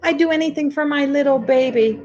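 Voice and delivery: high, cooing baby talk, mock doting; doting mother. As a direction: coo over the baby like a doting mom. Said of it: "It's like".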